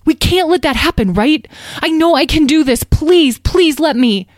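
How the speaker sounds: resolute youngster building to high, loud pleading; earnest young dragon. Acted. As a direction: stand firm, then beg to be allowed.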